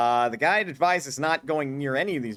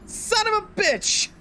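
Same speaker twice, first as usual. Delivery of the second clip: much higher, slow furious cry; frustrated shout; 4 dB louder, slower than usual. Real, not acted. Player cursing the game after the fall, real frustrated high cry. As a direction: curse the game at the top of the voice. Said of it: "I know the tone of voice I said that with".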